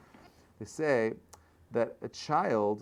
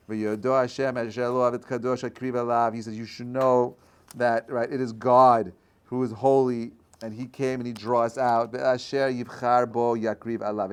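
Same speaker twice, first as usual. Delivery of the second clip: louder, measured recitation of the verse; a teacher reciting Moses's answer, louder; declaiming scripture; 8 dB louder than usual. Acted. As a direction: proclaim the verse.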